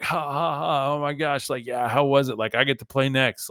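put-on teen ribbing with a fake ha-ha; mocking teenagers. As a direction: play excited teens crowding round a game. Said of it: "like a bunch of teenagers".

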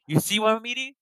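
high-pitched, put-on outrage; mock indignation. Diner defending his tofu from a trainer, mock indignation. Acted confrontation over tossed food.

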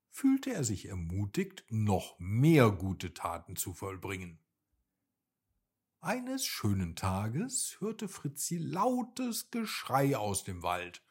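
usual speaking voice

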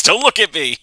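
high, loud quoted outburst; acted shout. Quoting a cartoon character hiding behind curtains, shouted. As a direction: shout it in mock panic. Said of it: "and just shouts".

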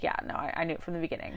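quiet, near-whispered smug admission; smug mutter. Retold or imitated. Gordy quietly admitting he knew from the start, softly smug. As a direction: mutter it like you knew all along.

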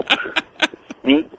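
ordinary delivery

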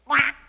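short, loud quack; duck quack. A narrator's reading. Duck answers the kitten with a loud quack. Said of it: "said the duck".

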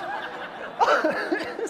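high, breath-driven burst of laughter. Real, not acted. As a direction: break up at your own joke. Laughs at his tablecloth joke.

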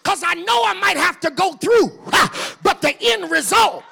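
loud whooping preacher cadence, gasped ha after each phrase; preaching at full force. Acted. Preacher whooping to the church at the sermon's climax, loud and rhythmic.

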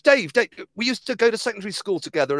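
quick, high, eager insistence; insisting old friend. Retold or imitated. Friend insists to the stranger they went to school together, eager and fast. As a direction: push the fake reunion, rushing.

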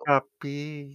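mock woman. Mocking the woman's voice.

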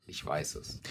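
breathy whispered aside. Acted. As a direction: whisper it under your breath. Repeats own boast.

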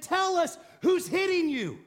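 high, loud mock taunt. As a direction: jeer it like a tormentor. Mockers taunting the blindfolded Jesus.

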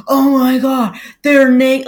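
mock the shocked viewers. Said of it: "the people who are like".

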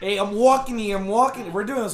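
hammed-up Jersey and New York accent. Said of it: "like, Jersey, like, New York".

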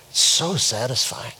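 lightly voiced earnest grandson, low and soft; serious five-year-old. Grandpa quotes his grandson on the mulch, mock serious. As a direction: quote a small boy in all seriousness. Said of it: "And he was serious".

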